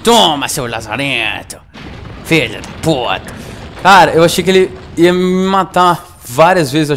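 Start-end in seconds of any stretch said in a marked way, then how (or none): 0.0-3.3 loud, slow cursing yells; gloating rage at the dead monster; triumphant cursing. Real, not acted. Player gloating over the killed monster, slow loud curses.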